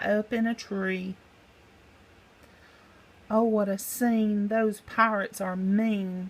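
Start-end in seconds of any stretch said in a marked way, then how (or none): none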